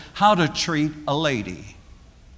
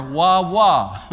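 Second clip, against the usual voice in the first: put-on toddler voice; 2 semitones lower than usual. Shown as a demonstration. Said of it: "when they want water, what they ask for".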